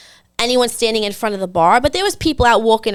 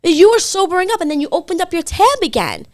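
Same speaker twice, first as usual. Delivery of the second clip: put-on high-pitched reproach to a drunk date; retold indignation; 7 semitones higher than usual. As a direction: scold him, pitched up. Retells her angry confrontation.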